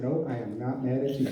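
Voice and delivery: low, hushed insistence; quiet, held-in answer; restrained denial. Retold or imitated. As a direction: drop pitch and volume to deny it. Retold denial to his sister.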